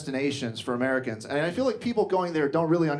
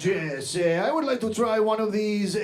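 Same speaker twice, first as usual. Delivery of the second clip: put-on halting dad with long "eh" fillers in a Latin accent; heavy Latin American accent; slower than usual. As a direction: search for English words in a thick Spanish accent. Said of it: "very heavy Latin American accent".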